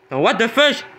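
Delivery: high shocked outburst. Real, not acted. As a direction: blurt it out in disbelief. Shock at losing mangoes.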